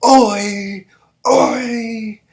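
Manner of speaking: high, loud chanted battle shout; army war cry. Retold or imitated. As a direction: shout it like a whole army roaring. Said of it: "Everybody was like".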